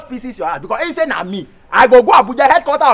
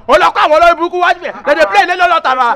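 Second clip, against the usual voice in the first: loud, fast, higher outburst; fast excited rant; 7 semitones higher, 7 dB louder, faster than usual. Acted. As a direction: rattle it off in disbelief. Excited complaint about music.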